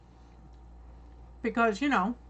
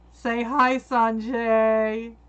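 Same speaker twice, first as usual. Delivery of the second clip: calling out; 9 dB louder, much slower than usual. Real, not acted. Calling to husband in room.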